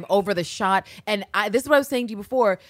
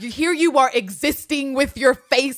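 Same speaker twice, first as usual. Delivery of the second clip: loud, high mock-outraged preacher; slow, hammed-up accusation of a temptress; tempted priest's rant; 5 semitones higher, 6 dB louder, slower than usual. Host mimics the Hunchback priest accusing Esmeralda, ranting.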